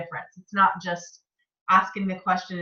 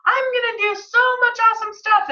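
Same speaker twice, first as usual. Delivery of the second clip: much higher, bubbly excited rush; 13 semitones higher, 4 dB louder than usual. Retold or imitated. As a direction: gush with big excitement. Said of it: "and then immediately feeling overwhelmed".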